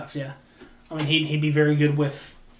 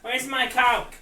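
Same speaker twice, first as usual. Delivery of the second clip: mock Kessel demand; 8 semitones higher, 4 dB louder, much slower than usual. Continuing Kessel joke.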